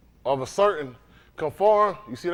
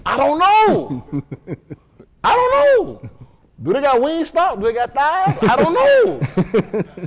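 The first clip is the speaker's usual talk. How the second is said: loud, very high-pitched bewilderment; comic uncertainty; 7 semitones higher, 4 dB louder, slower than usual. Acted. Preacher worrying about food in heaven, high comic doubt. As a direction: plead ignorance, voice shooting way up.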